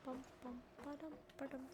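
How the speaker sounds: ordinary delivery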